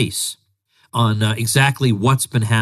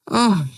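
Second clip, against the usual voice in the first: exasperated ugh; 9 semitones higher than usual. Real, not acted. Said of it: "exasperated groan".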